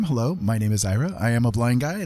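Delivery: normal talking voice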